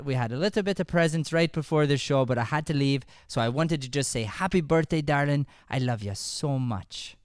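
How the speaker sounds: usual speaking voice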